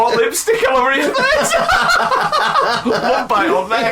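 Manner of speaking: talking through loud laughter; cracking up mid-sentence; laughing. Real, not acted. Laughing at a gross joke.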